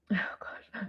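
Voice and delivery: unvoiced whisper to herself; whispered dismay. Real, not acted. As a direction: whisper it under your breath. Losing her train of thought.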